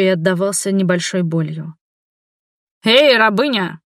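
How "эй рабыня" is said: rough call. A narrator's reading. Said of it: "грубо окликнул".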